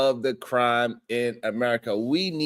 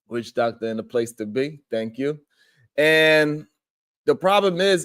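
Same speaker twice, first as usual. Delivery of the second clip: ordinary delivery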